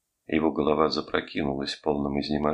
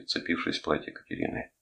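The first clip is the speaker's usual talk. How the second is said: usual speaking voice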